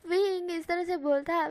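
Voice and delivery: ordinary delivery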